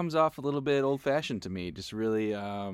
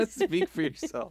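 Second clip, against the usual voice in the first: real laugh through the words, higher and slow; 6 semitones higher, slower than usual. Real, not acted. Co-host echoing a retort about age, laughing.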